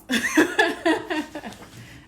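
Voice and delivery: high, loud burst of laughter; emotional laugh. Real, not acted. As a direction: laugh through the tears. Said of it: "without getting emotional".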